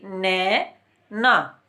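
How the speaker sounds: नैना drawn out very slowly on the ऐ; नैना spoken slowly and deliberately. Shown as a demonstration. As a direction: lengthen the ऐ in नैना. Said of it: "ए और ए".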